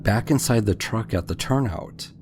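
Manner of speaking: usual speaking voice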